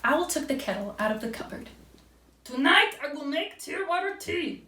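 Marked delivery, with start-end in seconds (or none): 2.4-4.6 high-pitched character voice for an owl; storybook Owl. A narrator's reading. Owl announces tear water tea, high character voice. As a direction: voice a little owl announcing a plan. Owl's line in read-aloud story.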